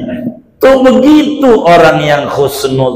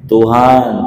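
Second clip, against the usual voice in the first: ordinary talk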